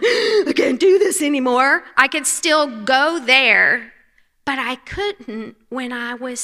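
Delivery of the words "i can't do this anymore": despairing cry. Retold or imitated. Voicing her own despairing thoughts.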